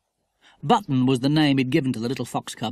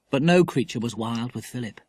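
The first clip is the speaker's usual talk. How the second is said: ordinary delivery, nothing marked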